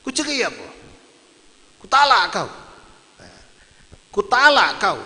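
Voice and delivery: high-pitched challenge on "cerai" and "talak"; questioning intonation. Shown as a demonstration. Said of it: "tapi intonasinya pertanyaan".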